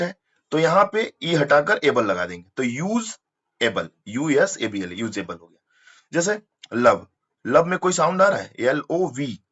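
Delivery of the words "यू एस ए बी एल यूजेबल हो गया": usual speaking voice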